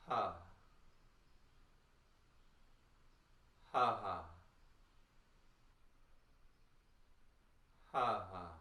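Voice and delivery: short punched ha sounds; touch sound with a ha; vocal warm-up ha. Shown as a demonstration. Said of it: "As you touch sound".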